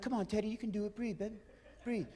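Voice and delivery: very soft mock-tender murmur; hushed coaxing. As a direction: coax him gently, barely voiced. Gently coaxing choking friend.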